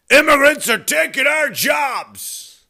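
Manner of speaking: high, slow, drawn-out holler; mock outraged shout. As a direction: parody a bigot yelling in outrage. Mimics old anti-immigrant outcry.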